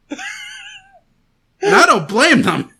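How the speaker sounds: loud, high melodramatic wailing; mock despair. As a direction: wail it like a hammy tragedy. Mock despair about guest.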